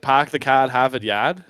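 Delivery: put-on Boston accent with dropped R's. Shown as a demonstration. Guest shows off his Boston accent on request. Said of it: "My, my Boston accent".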